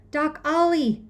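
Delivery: high, loud gasped cry; breathless plea for the doctor; gasping. A narrator's reading. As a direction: gasp out the name in panic. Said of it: "I gasp".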